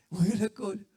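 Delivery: repeats the punchline through a laugh. Real, not acted. Preacher laughs retelling the wagging tail joke to congregation, laughing. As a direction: chuckle it out, enjoying your own joke. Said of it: "¿Estuvo bueno ese?".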